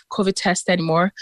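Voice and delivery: ordinary talk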